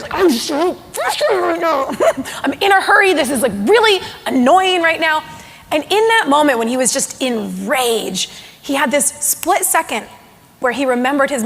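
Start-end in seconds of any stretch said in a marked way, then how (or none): 0.1-5.2 mock fuming frustration. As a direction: play the cranky man about to boil over. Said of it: "smoke coming out of his ears".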